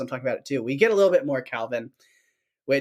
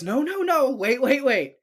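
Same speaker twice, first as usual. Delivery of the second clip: high, urgent pleading; hammed-up alarm, easing slightly; Tracy; 10 semitones higher than usual. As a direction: imitate a frantic attempt to stop someone. Retells Tracy halting an attack.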